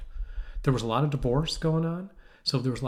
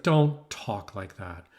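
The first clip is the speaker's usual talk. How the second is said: slow, lower paternal scolding; retold angry father; 5 semitones lower, much slower than usual. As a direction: lay down the law as a mock stern dad. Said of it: "My father got mad".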